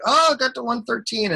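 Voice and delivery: high-pitched excited cry, put on; mock excited bulls. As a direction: imitate thrilled traders jumping in. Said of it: "the bulls who are like".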